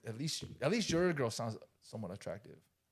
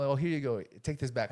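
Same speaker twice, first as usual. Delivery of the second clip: normal talking voice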